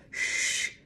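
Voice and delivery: urgent "shh" for silence; long warning shush; cautioning shush. A narrator's reading. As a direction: hush them with a warning hiss. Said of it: "Sam cautioned".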